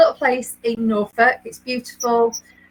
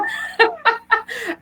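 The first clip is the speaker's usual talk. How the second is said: laughter; 12 semitones higher than usual. Real, not acted. Laughing at own mistake.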